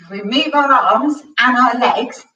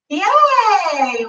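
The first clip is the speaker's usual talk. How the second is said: cheering; 10 semitones higher, 3 dB louder than usual. Real, not acted. Cheering at song's end.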